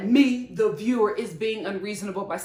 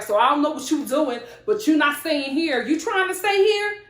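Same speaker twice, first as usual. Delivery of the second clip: acted indignant challenge, louder, rising into a higher question; confrontational retelling; 5 semitones higher, 6 dB louder than usual. Woman confronts her ex about staying here, confrontational. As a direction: voice her pushing back, loud and incredulous by the end.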